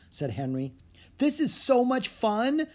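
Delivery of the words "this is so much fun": put-on high, drawn-out girlish delight; excited little girl's exclamation. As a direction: gush it slowly, thrilled by the ride. Girl delighted by the bobbing boat.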